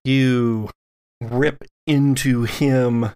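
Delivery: ordinary talk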